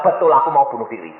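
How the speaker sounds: ordinary talk, nothing marked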